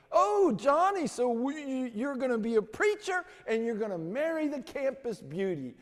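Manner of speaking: high, loud mocking taunt. The preacher boys mock Johnny's dream of the campus beauty. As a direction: jeer at him in a high voice.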